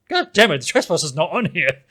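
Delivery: exaggerated retold annoyance; mock exasperation. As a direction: play up a comic frustrated realization. Retells realizing the shirt omission.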